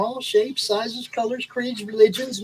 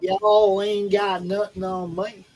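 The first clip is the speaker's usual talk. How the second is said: slow, drawn-out Southern drawl; Southern accent; much slower than usual. Shown as a demonstration. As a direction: show off your best Southern accent. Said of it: "Let's hear your best Southern accent".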